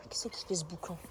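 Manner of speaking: soft whisper, voice unvoiced. Real, not acted. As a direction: whisper so the quails stay. Whispering near the birds.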